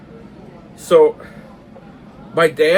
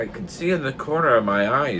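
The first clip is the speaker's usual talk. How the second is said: usual speaking voice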